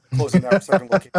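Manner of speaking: laughing through the words, fast and loud. Real, not acted. Telling his host about closing the second restaurant, laughing. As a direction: laugh it out as the relief spills over.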